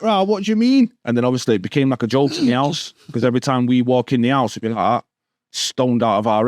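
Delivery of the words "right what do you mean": mock innocent. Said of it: "We'd be like".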